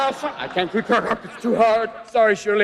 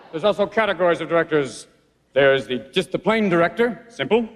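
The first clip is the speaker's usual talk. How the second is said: deep announcer voice; 6 semitones lower than usual. Acted. Low presenter voice held.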